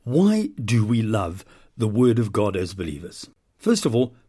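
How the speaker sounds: normal talking voice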